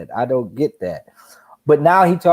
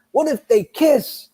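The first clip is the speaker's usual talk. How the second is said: mock commentator; 9 semitones higher, slower than usual. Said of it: "randomly saying dumb shit".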